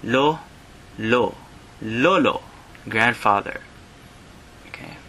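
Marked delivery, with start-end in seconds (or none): 0.0-2.4 "lolo" broken up: lo-, lo-, LOH-loh; syllable by syllable. Shown as a demonstration. Teacher breaking down "lolo" for learners. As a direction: build "lolo" one syllable at a time. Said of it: "Grandfather is lolo".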